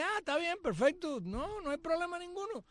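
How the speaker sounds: high, falsely cheerful brush-off; to the host who refused to invite him, mock agreement; sarcastic okay. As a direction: sound fine with it while not meaning it. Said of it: "Y yo le dije".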